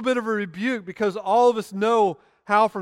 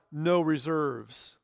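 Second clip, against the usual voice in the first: very slow, measured reading of the words; solemn slow inscription read; slow solemn quote; 4 semitones lower, 5 dB quieter, much slower than usual. Acted. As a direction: let each word land slowly. Written words read slowly.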